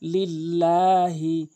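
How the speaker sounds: লিল্লাহি slow, লাম stretched one alif; drawn out Lillahi. Shown as a demonstration. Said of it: "লাম এখানে একালিফ টেনে পড়ব". (লিল্লাহি ধীরে, লাম টেনে)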